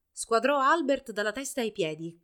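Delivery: usual speaking voice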